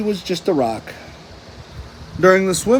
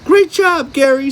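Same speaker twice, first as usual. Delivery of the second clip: very high, louder cheer; much higher joyful shout; overjoyed cry; 8 semitones higher, 5 dB louder than usual. A narrator's reading. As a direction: burst with pride. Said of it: "SpongeBob cried".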